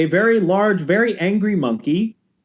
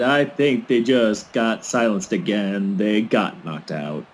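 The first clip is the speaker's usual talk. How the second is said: low slow inner voice; 10 semitones lower, 3 dB quieter, slower than usual. Pretend. Voicing a knocked-out wrestler's thoughts.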